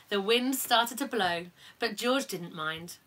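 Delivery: plain reading voice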